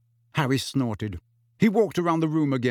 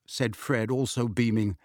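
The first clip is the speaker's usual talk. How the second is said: ordinary delivery, nothing marked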